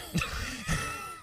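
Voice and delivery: high squealing laugh burst; shrieking laugh. Real, not acted. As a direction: let out a shrill laugh.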